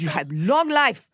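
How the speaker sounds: slow imitated Korean accent; Doctor Na's Korean accent. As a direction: pronounce it slowly, mimicking a Korean accent. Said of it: "I'm imitating her".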